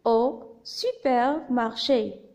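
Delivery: slow, separated syllables. Shown as a demonstration. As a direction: repeat it slowly, a syllable at a time. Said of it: "Je répète".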